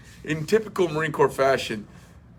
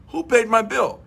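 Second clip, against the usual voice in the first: surprised question; 10 semitones higher, 3 dB louder than usual. Retold or imitated. Retold surprise at paid bill.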